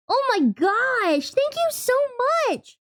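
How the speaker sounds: very high, slow thrilled thanks; excited. Real, not acted. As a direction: gush with delight. Thanking subscriber again.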